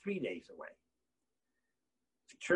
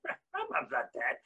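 high, slow laughing rebuttal; laughing denial; 11 semitones higher, 3 dB quieter, slower than usual. Real, not acted. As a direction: laugh it off, drawn out.

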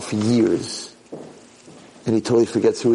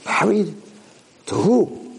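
high-pitched disbelief; stunned questioning; 9 semitones higher, slower than usual. Acted. Kitchen boy told he is getting married, stunned questioning. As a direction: ask it startled and high. Prince hears of forced wedding.

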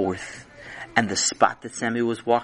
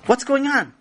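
put-on high small child's voice; a kid woken in the night; sleepy child; 11 semitones higher than usual. Child Chaim wakes.

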